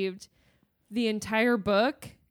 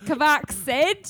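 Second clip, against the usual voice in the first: exaggerated cockney, higher and louder; mock the slip into slow cockney; hammed-up cockney; 4 semitones higher, 5 dB louder, slower than usual. Shown as a demonstration. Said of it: "He got real cockney at the end there".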